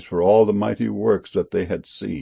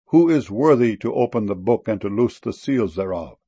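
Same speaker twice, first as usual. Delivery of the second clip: usual speaking voice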